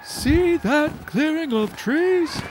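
high, slow call over wind; owl calling in flight. Acted. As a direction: call out while flying. Giant owl calling mid-flight.

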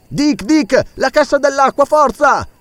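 high, pressing command; urgent order. Acted. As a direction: push Dick to act now. Doctor urges throwing ballast. (il dottore incita Dick, concitato)

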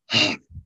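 short, forceful huff of air; big animal huff. Retold or imitated. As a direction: imitate a big animal huffing. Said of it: "big animal huff".

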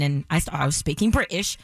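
normal talking voice

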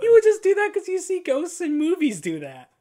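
high, loud teasing. Real, not acted. Teasing co-host.

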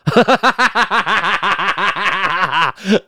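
long, high rapid cackle; long laugh. Real, not acted. Laughs at the other's answer.

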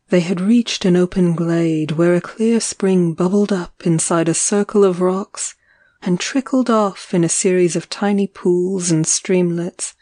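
ordinary delivery, nothing marked

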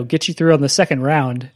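ordinary delivery

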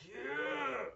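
mock telethon cry, drawn out and high; high strained yell. Imitates the telethon.

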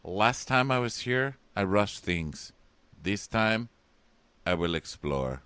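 halting mock-solemn declaration; Shoshana. Shoshana reflects on the tour boat, halting mock-dramatic. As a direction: declare it in separate, weighted phrases. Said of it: "And Shoshana goes".

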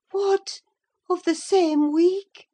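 high, loud disbelief; incredulous high-pitched question; exclaiming. A narrator's reading. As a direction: exclaim in astonishment, pitch jumping up. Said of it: "she exclaimed".

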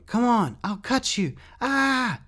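very high, cracking scream; frantic, adrenaline-fuelled shriek; screamed back. A narrator's reading. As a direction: scream a threat, trying to sound menacing. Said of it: "Rat screamed back".

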